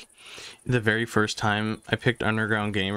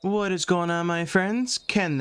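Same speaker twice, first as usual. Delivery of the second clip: high, bright welcome to viewers; excited greeting; 8 semitones higher than usual. Real, not acted. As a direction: greet the audience with energy.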